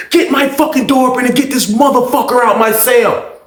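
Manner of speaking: high yelled demand; yelling. Retold or imitated. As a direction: holler it through the cell.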